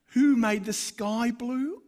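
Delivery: mock his own clumsy opener in disbelief; mocking own line. Preacher mocking his clumsy question to his friend, slow and incredulous. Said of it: "I win it".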